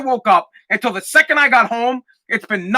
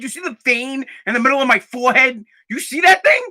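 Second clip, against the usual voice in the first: ordinary delivery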